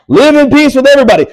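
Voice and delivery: loud, high holler at other drivers; hammed-up irate driver shout; mock road-rage yell.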